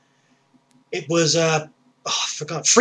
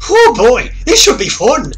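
louder, higher, gleeful; gleeful thug; 6 semitones higher, 6 dB louder than usual. Acted. Thug looks forward to robbing the old man, loud and gleeful. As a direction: relish the coming job.

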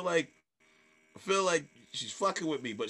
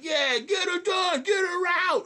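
very high, loud, drawn-out holler; rallying the crowd; 6 dB louder than usual. Retold or imitated. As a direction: whip up the room from the stage. Leading crowd chant on stage.

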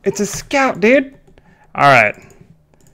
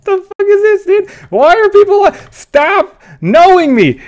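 incredulous shout; 13 semitones higher, 6 dB louder than usual. Real, not acted. Strangers keep recognising him.

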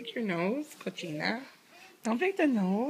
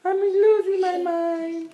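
put-on higher, louder and slow, speaking for the baby; drawn-out mock complaint; 6 semitones higher, 6 dB louder than usual. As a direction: stretch the pretend baby complaint loudly.

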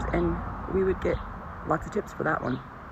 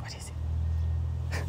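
soft whisper, very slow; whisper to pet; 5 dB quieter, much slower than usual. Real, not acted. To her tired pet, a slow gentle whisper. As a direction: hush it to the sleepy dog.

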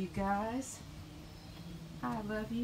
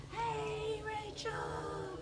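much higher, softer cooing to the cat; 13 semitones higher, 5 dB quieter, much slower than usual. Real, not acted. Cooing to cats.

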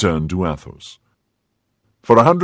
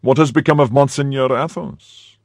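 a higher, commanding cardinal voice; 7 semitones higher, 3 dB louder than usual. Acted. The cardinal asks Porthos where Athos is, commanding. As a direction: ask it as the cardinal, higher than the narration.

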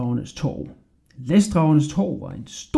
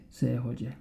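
ordinary delivery

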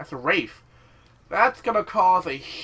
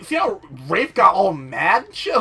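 ordinary talk

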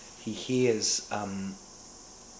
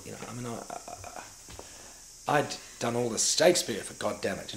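ordinary delivery

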